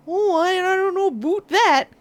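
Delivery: put-on South Central Ontario accent, pitched high. Streamer mocking the accent Americans hear most, South Central Ontario accent. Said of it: "that is specific to that one area".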